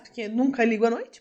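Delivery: usual speaking voice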